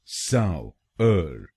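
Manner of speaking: सावर drawn out very slowly as saa-wer; very slow syllable by syllable. Shown as a demonstration. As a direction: stretch सावर out for learners. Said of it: "तो बनेगा सावर".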